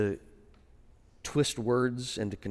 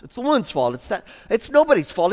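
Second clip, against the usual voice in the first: much higher, louder pleading excuse, often fast; whiny Adam; 10 semitones higher, 10 dB louder, faster than usual. Pretend. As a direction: whine at God like a cornered husband. Adam shifting blame.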